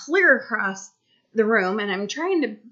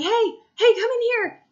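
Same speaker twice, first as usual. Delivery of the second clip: high, raised-voice holler; calling out; 12 semitones higher, 3 dB louder than usual. Retold or imitated. Mom at open bathroom door calling son, high holler. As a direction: summon the child, pitched way up. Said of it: "I'm like".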